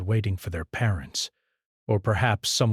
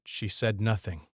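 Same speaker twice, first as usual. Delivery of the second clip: usual speaking voice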